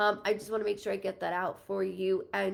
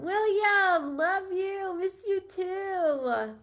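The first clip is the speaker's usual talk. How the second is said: higher, slow, lightly voiced message; relaying a spirit; 10 semitones higher, 5 dB louder, much slower than usual. Medium relaying a spirit's love to a viewer. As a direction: pass on the words gently.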